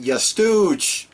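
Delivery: high, drawn-out mock yell; imitated yell. Podcaster quoting a wrestler yelling at someone, high mock yell. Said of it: "leaned up against a road case yelling".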